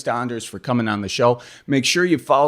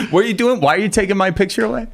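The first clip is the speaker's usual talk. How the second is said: high-pitched, fast, mock-indignant; 11 semitones higher, faster than usual. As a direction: squeak it out, offended and quick.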